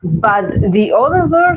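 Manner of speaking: ordinary talk, nothing marked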